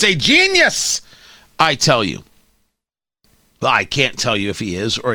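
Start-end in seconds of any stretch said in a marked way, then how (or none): 0.0-1.0 mock praise. Mocking what fans say.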